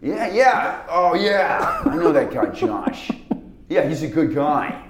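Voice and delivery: loud laid-back "hey, man" drawl; easygoing Rockwell swagger; Sam Rockwell impression. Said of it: "That's disturbingly good".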